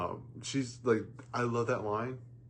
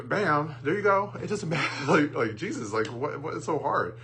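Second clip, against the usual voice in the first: high, loud, half-laughing exasperation; exasperated; 7 semitones higher, 5 dB louder than usual. Real, not acted. Reviewer ranting that picking a song is easy, exasperated. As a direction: push it up high as if it's that easy.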